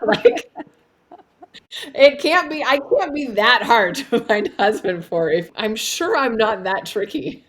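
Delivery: high, loud, laughing through the words. Real, not acted. To the host, laughing at how easy husband-finding should be. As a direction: giggle it out, amused at yourself. Laughing at her own mantra.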